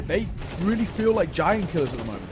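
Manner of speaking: ordinary talk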